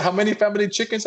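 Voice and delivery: put-on egging the crying kid on, quick and high; mock teasing coworker. Coworker taunting the crying kid over his paycheck, high gleeful tease.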